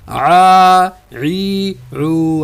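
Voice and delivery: عا عی عو voiced from mid-throat. Shown as a demonstration. Teacher shows students throat sound of ع. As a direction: press عا from the middle of the throat. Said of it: "حلق کے درمیان سے".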